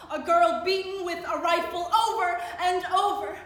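forceful, high dramatic recital; anguished outburst. Acted. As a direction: cry it out as horrified witness. Horror recounted in testimony poem.

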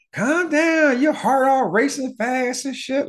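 high, mocking voice of the older girl; a lightly high girl's voice; voiced girlfriend. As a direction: play her high and bossy, telling him to calm down. Girl voiced calming him in story.

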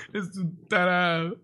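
slow words trailing through laughter; laughing. Real, not acted. Storyteller teasing his absent friend, laughing. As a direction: laugh helplessly while teasing.

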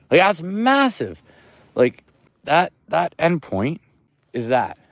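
loud, slow, marvelling; excited. Real, not acted. Showing viewers the map, loud and amazed. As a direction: hammer home how huge it is.

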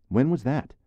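high, eager question; curious. Acted. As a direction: ask with young curiosity. Young officer asks.

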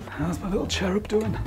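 soft teasing whisper. Real, not acted. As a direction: whisper a playful greeting.

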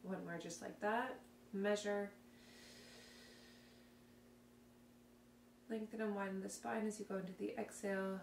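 soft, calm guided breathing cues; calm guided exercise voice. Acted. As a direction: talk them through it gently and quietly.

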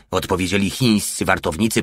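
usual speaking voice

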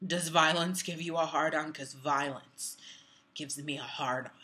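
lightly voiced creep, sinking low and slowing; creepy man. Quoting the increasingly creepy guy.

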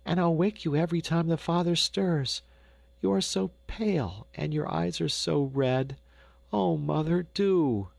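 put-on a touch of a child's earnest plea; pleading young girl. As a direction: plead with mother, lightly voiced child. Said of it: "The child pleaded in vain".

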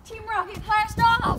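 cartoon villain cry.

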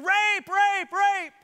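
high, loud mock scream of the accusing wife. Potiphar's wife screams at the household, loud high mock scream. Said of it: "his wife started screaming".

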